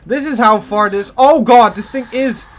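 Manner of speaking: loud, high horrified outburst; shocked gamer shouting; horrified yell. Real, not acted. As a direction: cry out in real alarm. Horrified at the enemy's range.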